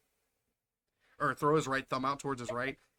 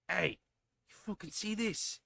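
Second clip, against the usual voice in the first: put-on Aussie accent; 5 semitones higher, 5 dB quieter than usual. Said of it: "He sounds thickly Aussie".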